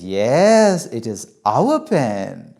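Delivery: loud, drawn-out delighted approval; gushing motherly delight; very happy mother. Acted. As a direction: praise the child with big swoops, ending high. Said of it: "my mother becomes very happy".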